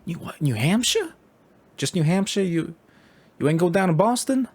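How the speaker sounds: put-on older stranger disbelieving they only visited New Hampshire; incredulous New Yorker. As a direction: play him baffled, a New York old-timer. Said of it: "He's like".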